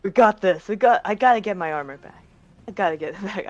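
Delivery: fired-up and in a hurry; urgent self-rallying. Acted. Acting out own character rallying.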